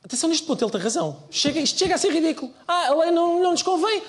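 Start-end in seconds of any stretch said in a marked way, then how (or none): 2.7-4.0 high, whiny mock voice; mocking whiny politician. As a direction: sneer it in a silly high voice. Mocking the party's excuse.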